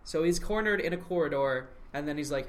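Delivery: ordinary talk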